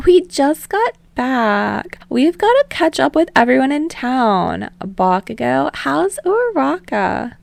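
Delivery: put-on high, eager girl voice; high excited young woman; bubbly Ashido. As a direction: bounce it up, excited.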